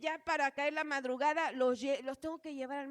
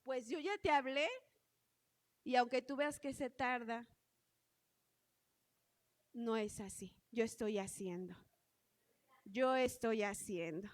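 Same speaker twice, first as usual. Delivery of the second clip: voice of the Lord; 4 semitones lower than usual. Retold or imitated. The Lord answering the anxious mother, lower and calm. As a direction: answer like God reassuring a worried child. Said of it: "me dice el Señor".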